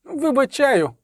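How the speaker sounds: high, squeezed poet's voice; squeezed-out forgiveness. A narrator's reading. Poet pardoning his executioner, squeezed out high. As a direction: force it out high, then let it fall. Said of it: "вичавив Любисток".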